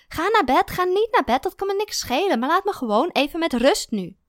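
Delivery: high, loud exasperated scolding; fed-up partner raising the voice; irritated outburst. A narrator's reading. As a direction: let the annoyance boil over. Narrator snaps at partner.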